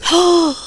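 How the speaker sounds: sharp, high theatrical intake of breath; indignant gasp. Acted. Acted outrage at dad's claim.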